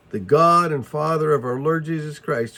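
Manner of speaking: ordinary talk, nothing marked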